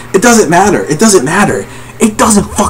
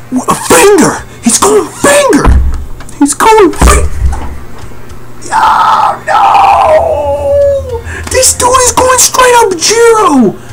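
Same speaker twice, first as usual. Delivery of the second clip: very high-pitched disbelieving squeal; hyped freak-out; 13 semitones higher than usual. Real, not acted. Reader sees he's going finger, high excited freak-out. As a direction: squeal it, voice shot far up in disbelief.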